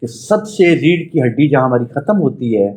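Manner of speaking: measured meditation voice with long pauses; guided meditation. Acted. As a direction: guide them inward, phrase by phrase. Leads a chakra meditation.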